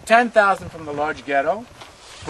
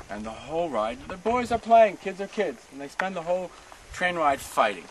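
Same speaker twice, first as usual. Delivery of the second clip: ordinary talk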